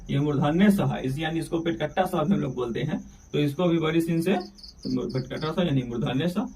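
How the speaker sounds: normal talking voice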